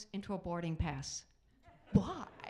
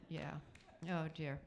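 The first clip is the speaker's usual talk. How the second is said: usual speaking voice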